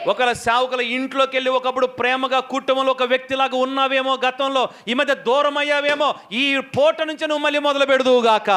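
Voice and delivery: rousing sermon exhortation; fervent preacher's call; preaching. Acted. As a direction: urge the church to begin the work. Preaching to the congregation.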